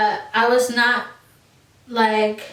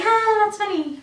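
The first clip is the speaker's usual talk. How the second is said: brushing it off; 10 semitones higher than usual. Retold or imitated. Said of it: "I would brush it off".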